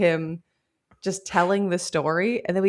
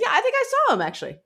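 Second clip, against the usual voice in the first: very high and rapid, eager; eager girl voice; much faster than usual. Retold or imitated. Host voicing the other girl at the mirror, high and rapid. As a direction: squeal it out in a rush.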